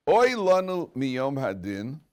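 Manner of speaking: drawn-out solemn quotation; lament recitation. Acted. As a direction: declaim the woe slowly. Recites the medrash.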